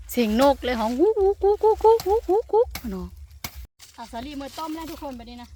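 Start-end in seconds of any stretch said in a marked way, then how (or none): 0.8-2.7 high, light clucking bird call; bird call imitation. While picking in the garden, imitating the bird she hears. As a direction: mimic the bird cooing in the trees.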